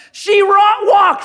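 very high, loud cry of joy; overjoyed parent shouting the news; thrilled shout. Pretend. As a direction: announce the first steps in delight. Parents celebrating first step.

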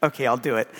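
ordinary talk, nothing marked

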